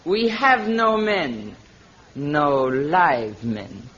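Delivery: the queen telling Tarzan the men are dead, slow and sinister; slow and ominous. Acted. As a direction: let each word fall slowly, chillingly. Queen's chilling revelation.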